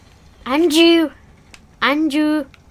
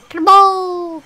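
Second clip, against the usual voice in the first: loud, higher, drawn-out whoop; cannonball shout; 6 semitones higher, 12 dB louder than usual. Real, not acted. Player yells Cannonball mid-game, loud gleeful call. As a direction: shout it out as a battle cry. Calling out while leaping in game.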